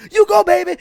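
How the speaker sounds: high, loud cheering mom; screaming mom voice. Retold or imitated. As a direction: shriek it like a proud mom ringside. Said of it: "she'd always scream at the ring".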